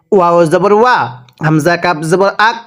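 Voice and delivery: usual speaking voice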